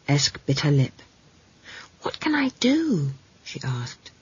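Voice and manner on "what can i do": high, hushed plea; small worried child. Acted. Girl asks Granny for help, high and hushed. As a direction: ask it helplessly, almost whispering.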